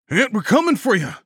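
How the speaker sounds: high, fast, loud yell. A narrator's reading. Rescuer to wounded man below, yelling. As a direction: yell urgent reassurance from afar. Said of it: "someone yelled".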